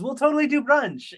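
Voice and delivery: high singled-out quote, lightly yelled; retold yell. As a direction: call it out high, imitating a yell. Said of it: "Madam G yells".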